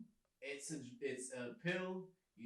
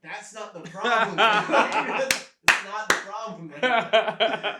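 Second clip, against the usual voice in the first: much louder and higher, cracking up; laughing protest; 7 semitones higher, 19 dB louder, much slower than usual. Retold or imitated. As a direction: insist it through loud laughter.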